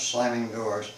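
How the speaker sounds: ordinary delivery, nothing marked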